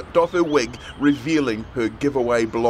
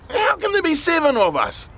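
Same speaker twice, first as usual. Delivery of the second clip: alarmed; 4 dB louder than usual. A narrator's reading. Tim alarmed at extra member.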